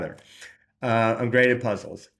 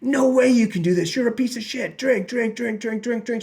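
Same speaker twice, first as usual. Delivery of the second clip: higher, harsh taunting inner voice; mock taunting inner demon, louder; inner critic voice; 8 semitones higher, 3 dB louder than usual. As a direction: jeer at yourself like a cruel inner voice. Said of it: "So that voice inside of me said".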